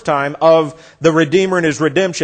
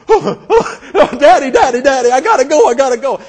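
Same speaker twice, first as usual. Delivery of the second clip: put-on much higher, louder wailing child's plea; begging little girl; 9 semitones higher, 6 dB louder than usual. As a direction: wail it like a small girl about to cry. Little girl begging before crying.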